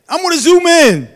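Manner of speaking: preacher's rising shout; sudden loud high repeat. Acted.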